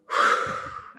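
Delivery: long breathy sigh out; releasing sigh, as a demonstration; demonstrated exhale. Shown as a demonstration. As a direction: let the breath go audibly. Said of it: "I let it out".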